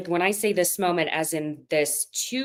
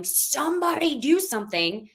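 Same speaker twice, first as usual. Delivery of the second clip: higher-pitched mock urging from offstage; imitated producer plea; 7 semitones higher than usual. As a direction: play a producer pleading for action. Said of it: "behind the camera going".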